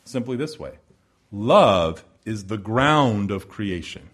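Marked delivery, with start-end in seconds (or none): 1.3-4.1 slow quote. Acted. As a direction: say it slowly with weight on love. One author put it simply this way.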